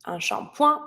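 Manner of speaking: shampoing without a final g; silent last letter. Shown as a demonstration. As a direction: leave the g of shampoing unspoken. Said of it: "I don't pronounce the last letter".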